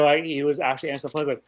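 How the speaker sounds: ordinary talk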